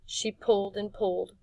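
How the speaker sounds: plain reading voice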